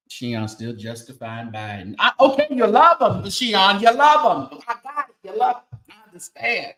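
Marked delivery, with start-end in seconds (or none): 2.0-6.7 exasperated teasing. Real, not acted. Teasing a chat member, Sheon.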